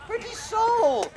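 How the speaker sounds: high, slow, cooing over her; a high, drawn-out coo of pity; tender pity. Acted. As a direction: melt at the sight of her. Puck pities the sleeping maiden.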